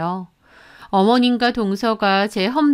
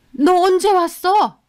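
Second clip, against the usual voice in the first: startled; 7 semitones higher, 3 dB louder than usual. Acted. Caught gossiping, startled mother-in-law.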